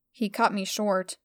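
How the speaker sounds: ordinary delivery, nothing marked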